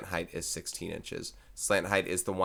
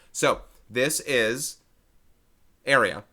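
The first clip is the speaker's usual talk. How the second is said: "So this is" drawn out slowly, then "area." landed loud; slow build to a loud "area."; slow emphasis; 3 semitones higher, 4 dB louder, slower than usual. Shown as a demonstration. Teacher stressing that surface area is area, slow and emphatic. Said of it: "I mentioned that loudly enough".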